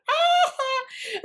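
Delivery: high mock scream of pain. Girl at camp getting her cut treated, mock scream. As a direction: shriek as the alcohol stings. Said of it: "And I was like".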